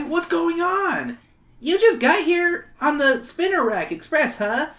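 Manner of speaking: high, whiny schoolkid; whiny kid voice. Acted. Wally character voice.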